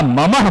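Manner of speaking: "ma-mah" for ममः, h after the last vowel; added visarga. Shown as a demonstration. Teacher showing another wrong reading, ममः with a final h. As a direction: say ममः as "ma-mah", ending on a breath of h. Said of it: "विसर्ग भी नहीं ना".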